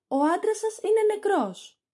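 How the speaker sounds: high, loud mock shock over the death; fake alarm. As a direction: cry it out as hammed-up dismay. Toinette announces master's death.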